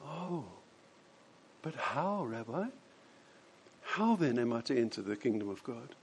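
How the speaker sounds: soft, hushed wondering question; earnest asking. Acted. Preacher voicing Nicodemus leaning in to ask the Rabbi, softly earnest. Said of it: "leaning forward and saying".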